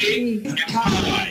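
slow, calm "Cake and pie"; just say "Cake and pie" slowly; slow and easy. Shown as a demonstration. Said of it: "You can just be like".

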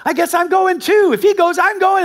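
put-on high, loud eager kid voice; younger brother. Hudson deciding to follow his brother, high eager voice. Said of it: "he's like".

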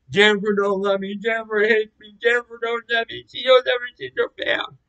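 high, rising wailed lament; distressed wail. Retold or imitated. Acting out despair over Jennifer, distressed high wail. Wailing about Jennifer's rejection.

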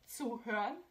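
"zuhören" as tsoo-hö-ren; German pronunciation. Shown as a demonstration. Host modelling the word for friends, German "zuhören". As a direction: say "zuhören" with ts start and rounded ö. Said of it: "It's pronounced".

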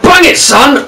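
high-pitched, excited urging; urgent cheer. Real, not acted. Gamer urging his player to shoot, excited.

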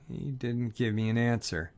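plain reading voice

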